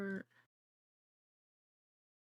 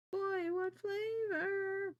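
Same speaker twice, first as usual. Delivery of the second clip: silly voice; 11 semitones higher, slower than usual. Pretend. Silly voiced bit while setting up.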